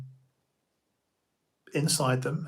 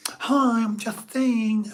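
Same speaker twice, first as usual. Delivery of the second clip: high, lisping mock-camp greeting; loud put-on Justine, lisped and drawn out; 8 semitones higher, 7 dB louder, slower than usual. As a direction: lisp it high and slow as a performed alter ego. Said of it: "start changing my voice".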